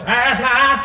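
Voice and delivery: loud, high, stretched presidential oratory; John F. Kennedy. Acted. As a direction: declaim it in an exaggerated speechmaker's voice. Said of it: "a thing that he was gonna do with his voice".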